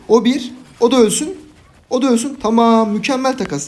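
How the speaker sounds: high, loud gleeful calls; thrilled gamer cheering the trade; excited calling out. Real, not acted. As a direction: crow over each kill. Celebrating kills in match.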